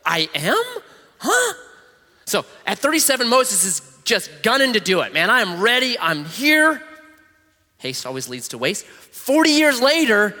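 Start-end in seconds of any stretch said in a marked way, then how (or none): 0.1-1.5 puzzled. Retold or imitated. 5.1-6.8 eager. Retold or imitated. Eager young Moses.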